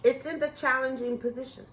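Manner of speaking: ordinary talk, nothing marked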